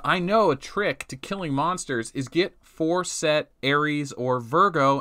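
ordinary talk

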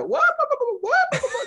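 very high wailing Beaker whoas; Beaker's whoa. Retold or imitated. Puppeteer Richard voicing Beaker off stage, very high wailing. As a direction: wail it like the frightened lab assistant puppet. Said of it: "do his thing".

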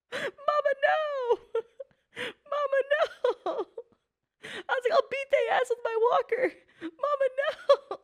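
high, laughing cry of mock protest; high laughing mock plea to mom. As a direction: beg your mama through helpless laughter. Pleading with mom, laughing hard.

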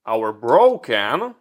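ordinary talk

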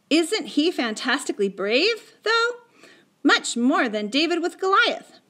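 put-on high, thrilled boy's voice; excited admiration. A narrator's reading. Patrick watching Little Bear attack, excited admiration. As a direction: marvel excitedly at the brave little fighter. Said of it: "fairly danced with excitement".

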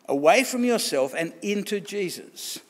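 normal talking voice

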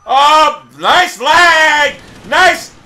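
loud, bitter mock praise; sarcastic shout. Real, not acted. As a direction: loudly congratulate the game with sarcasm. Sarcastic complaint about lag.

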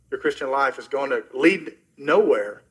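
ordinary delivery

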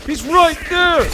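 high, loud, drawn-out yell; urgent callout. Pretend. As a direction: shout out where the enemy is. Calling out enemy position.

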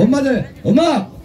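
high call for music; calling out cue. Acted. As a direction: cue the band with a lifted cry. Cueing the band.

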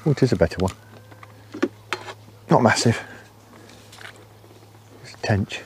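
ordinary delivery

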